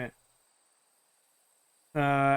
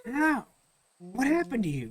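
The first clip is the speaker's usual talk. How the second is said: high-pitched, softer questioning; Luca; 5 semitones higher, 5 dB quieter than usual. Acted. Luca recognizing his changed friend, high bewildered questioning. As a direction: ask in a high, hushed character voice. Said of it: "Luca's voice for some reason by pitching high".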